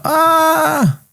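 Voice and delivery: high, strained mock death cry; dying man. Acting out the dying prisoner for his friend. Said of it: "What is going on?".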